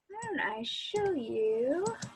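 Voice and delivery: ordinary delivery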